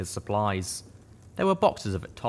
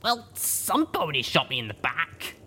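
raised heroine voice with a light growl; 7 semitones higher than usual. A narrator's reading. Wounded heroine growling at her companion, a light growl. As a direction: grumble it, irritated and sore. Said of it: "I growled".

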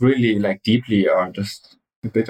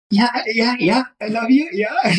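much higher, gushing mock eagerness; 13 semitones higher than usual. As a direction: overdo the eager yes-yes like a smitten fan. Mocking herself sounding too keen.

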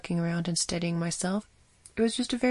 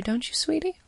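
sugary sweet-talk; 9 semitones higher than usual. Acted. Doctor sweet-talking the nurse.

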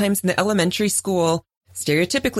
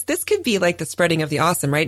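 ordinary talk, nothing marked

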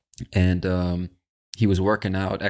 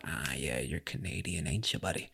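much lower and softer, playful murmur; low aside; 4 semitones lower, 13 dB quieter than usual. Pretend. Host imagines greeting the Canadian from the clip, low aside. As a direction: drop it right down, almost to yourself.

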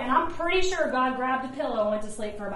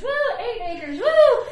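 excited whoop; 8 semitones higher, 4 dB louder than usual. Retold or imitated. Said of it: "I was like".

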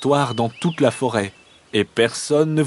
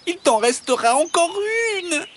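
high, whining fox plea; squeaky imploring wheedle; begging fox. A narrator's reading. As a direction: coax desperately, pitched way up. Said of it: "supplie le renard".

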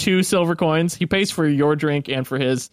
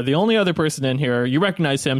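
ordinary talk, nothing marked

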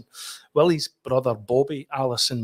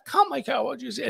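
much higher English woman, baffled; English copy taker; 13 semitones higher than usual. Retold or imitated. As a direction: operator giving up. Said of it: "And she said".

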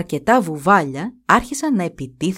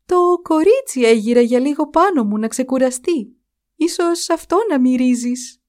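frightened; 6 semitones higher, 3 dB louder than usual. A narrator's reading. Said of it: "είπε τρομαγμένη η Πέτρα".

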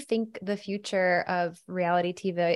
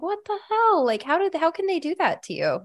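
much higher, indignant disbelief; retelling her shocked message to him, much higher; retold outrage; 9 semitones higher than usual. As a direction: squeal it like you can't believe they did that.

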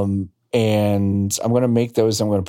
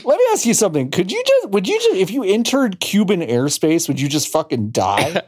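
mock incredulous; 8 semitones higher than usual. Teasing exaggerated question.

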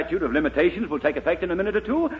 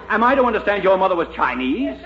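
ordinary talk, nothing marked

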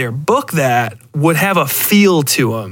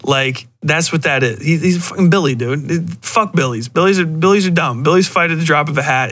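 usual speaking voice

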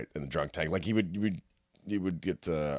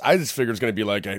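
normal talking voice

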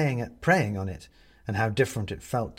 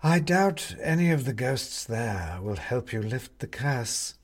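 slow, sly cat voice; a smug cat, slow and knowing; haughty cat; slower than usual. Acted. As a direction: purr the doubt out slowly. Cat character speaks.

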